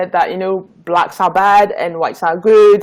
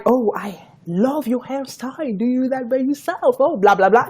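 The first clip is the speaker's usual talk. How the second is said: light mock-gushing, higher and softer; gushing admirer; 4 semitones higher, 4 dB quieter than usual. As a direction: imitate an empty compliment, tailing into blah-blah.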